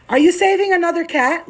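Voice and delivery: high, loud teasing to the cat; high playful. Real, not acted. Owner teasing her cat, high playful call. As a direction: tease the pet.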